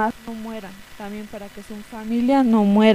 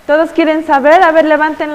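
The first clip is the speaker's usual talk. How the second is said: rousing question; 5 semitones higher, 8 dB louder than usual. Real, not acted. Teacher rouses the children.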